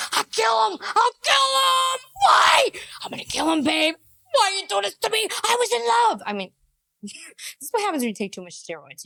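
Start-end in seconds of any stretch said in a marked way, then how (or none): 0.1-6.2 high mock-tearful shouting; hammed-up steroid meltdown; emotional jealous boyfriend. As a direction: swing between threats and wailing heartbreak. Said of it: "You get emotional".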